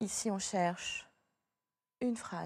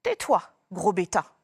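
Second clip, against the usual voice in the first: louder, sharp telling-off; scolding mother; 3 semitones lower, 7 dB louder than usual. Shown as a demonstration. Mother scolding Pipioli, loud and cross. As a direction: snap at the silly child to hush. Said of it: "c'est la maman qui s'adresse à Pipioli".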